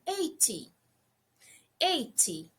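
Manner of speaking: Eighty unforced, the vowel stretched long; drawn-out eighty. Shown as a demonstration. Teacher showing students a long, soft Eighty. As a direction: stretch Eighty gently without pushing. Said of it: "você não força, mas prolonga".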